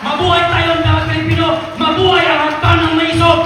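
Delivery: loud, building chant of mabuhay; loud closing cheer at the rally. Real, not acted. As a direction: shout it out to the crowd.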